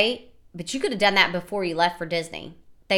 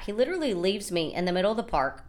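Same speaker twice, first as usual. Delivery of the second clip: ordinary talk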